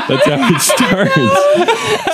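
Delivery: high, slow, laughing singsong; high drawn-out. Shown as a demonstration. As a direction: stretch it out way up high.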